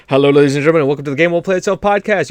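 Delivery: cheerful announcer welcome; a touch of announcer polish; podcast host intro. Acted. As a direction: open the show like a radio host. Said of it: "we didn't do the".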